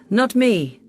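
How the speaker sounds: ordinary delivery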